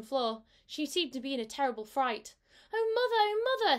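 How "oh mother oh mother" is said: high, loud, frightened kitten cry. A narrator's reading. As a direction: wail it like a scared little kitten. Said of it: "said Moppet".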